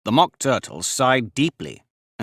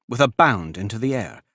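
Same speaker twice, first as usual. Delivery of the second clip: usual speaking voice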